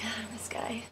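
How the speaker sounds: hushed, sighed aside to a friend; under-the-breath imitated grumble; exasperated whisper. Exasperated aside between two friends.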